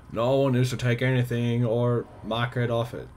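high, loud read-out of a stern notice; notice read aloud. Acted. Reads a game notice aloud.

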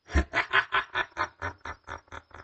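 long staccato evil cackle, fading away; evil laugh. Acted. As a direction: cackle in a steady pulse like a villain. Villain laughs after his threat.

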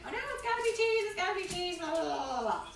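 high-pitched imitation of picky children; mock fussy kids. Mom imitates her kids refusing toppings, high mock fussing. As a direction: mimic kids' protest, high and trailing into blahs.

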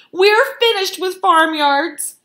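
high, loud, drawn-out wail; desperate cry. A narrator's reading. Starving foxes giving up on farmyards, desperate loud cry. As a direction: wail it out at full voice. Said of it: "they cried".

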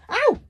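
sharp high "Ow!" of real pain; sudden yelp of pain. Real, not acted. A sharp yelp as the suitcase lands on her foot. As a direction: yelp as the case hits your foot.

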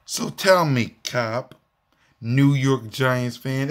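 usual speaking voice

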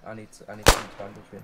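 urgent shout. Real, not acted. Wounded, needs bandage.

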